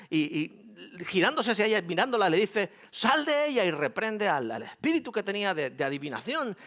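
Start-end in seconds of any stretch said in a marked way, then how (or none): 2.9-3.6 high, loud command to cast out a spirit; forceful rebuke, loud and high; commanding shout. Retold or imitated. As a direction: bark the order at the spirit.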